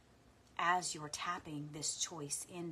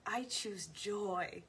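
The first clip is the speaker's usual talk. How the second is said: slow, higher with a playful lilt; 4 semitones higher, 3 dB louder, much slower than usual. Real, not acted. Teacher feeling like a little kid, giddy and playful. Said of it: "I feel like a little kid".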